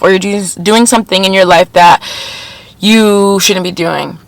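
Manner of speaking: ordinary delivery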